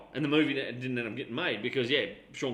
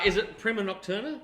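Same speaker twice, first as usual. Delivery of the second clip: ordinary talk, nothing marked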